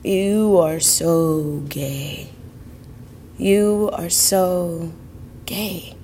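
slow, soft inner echo, fading out; echoing thought. Retold or imitated. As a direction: let it drift like a thought in your head. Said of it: "kept repeating in my head".

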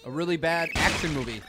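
ordinary delivery

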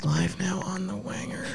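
slow hushed whisper. Pretend. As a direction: breathe it out secretly. Whispering announcement while posting.

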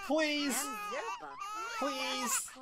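high, drawn-out plea; desperate begging. Pretend. Gamer begging Milani to come feed, desperate.

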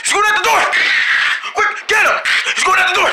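high urgent yell; alarmed shout. Acted. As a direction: raise the alarm. Alarm as he escapes.

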